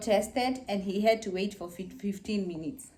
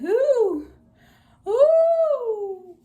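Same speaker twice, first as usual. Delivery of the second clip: high loud pained ooh; retold labor scream; 13 semitones higher, 8 dB louder than usual. Mother retelling contractions, loud high wail. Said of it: "I could start screaming like".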